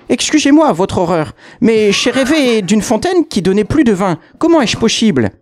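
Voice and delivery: put-on high, fluttery old woman; old concierge pretending to the devil, high voice; old lady voice. Old lady excuses herself to devil.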